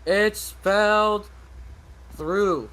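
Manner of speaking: "through" said slowly and deliberately, each word spaced; slow teacher correction. Shown as a demonstration. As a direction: spell it out like a strict teacher, "It's spelled through". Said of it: "I'm an English teacher".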